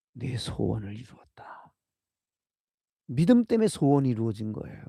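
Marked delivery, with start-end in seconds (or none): 0.1-1.7 whispered. Acted. Quoting Jesus's blessing.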